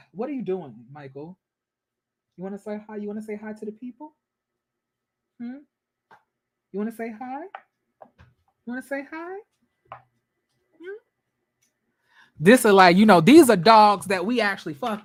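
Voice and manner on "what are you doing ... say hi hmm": high, soft coo to the dog. Real, not acted. Cooing to her dog Michael, soft baby talk. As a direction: sweet-talk the puppy softly.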